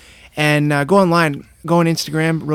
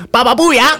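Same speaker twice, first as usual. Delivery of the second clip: goofy catchphrase; 10 semitones higher, 6 dB louder than usual. Retold or imitated. Repeating a movie catchphrase.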